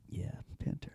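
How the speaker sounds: breathy whispered aside; barely voiced whisper. Real, not acted.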